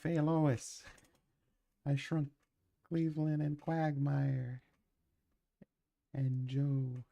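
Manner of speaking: high, hammed-up cartoon dad voice, slow on the names; Peter Griffin. Telling his wife he shrunk his buddies, in a terrible high cartoon dad voice. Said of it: "I do a terrible Peter".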